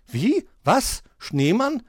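high, rising one-word questions; bewildered, each word swooping upward; confused. A narrator's reading. Magpie accused over the snowman, baffled. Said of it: "war die Elster verwirrt".

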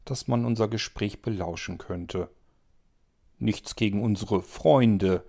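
plain reading voice

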